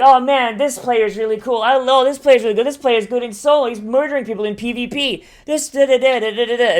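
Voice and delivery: mock developer fawning over a player; gushing devs. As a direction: gush like a dev impressed by a star player. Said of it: "they're like".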